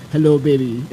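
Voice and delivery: low, smooth cool-guy voice; imitating a cool, deep-voiced man; cab driver's cool voice. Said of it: "He had a really cool voice".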